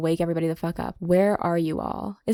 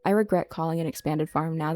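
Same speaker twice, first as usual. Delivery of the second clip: normal talking voice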